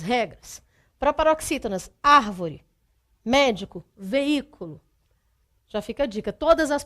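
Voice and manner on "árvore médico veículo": "árvore, médico, veículo", third-last syllable punched; antepenultimate stress. Shown as a demonstration. Teacher lists proparoxytone examples, antepenultimate stressed in "árvore, médico, veículo". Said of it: "cuja antepenúltima sílaba é mais forte".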